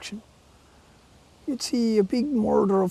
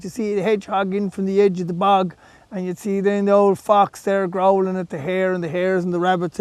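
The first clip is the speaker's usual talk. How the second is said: plain reading voice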